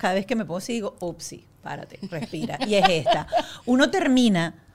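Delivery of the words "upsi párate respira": much softer, nearly whispered; hushed self-talk. Retold or imitated. Speaker reminds herself to stop, hushed self-talk. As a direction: check yourself under your breath.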